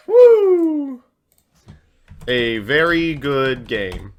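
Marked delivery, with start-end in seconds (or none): 0.1-1.0 very high, loud cheer; high whoop. Real, not acted. Streamer celebrating a win with a high whoop. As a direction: let out a wild whoop. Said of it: "You sounded so much like Kermit after your yawn".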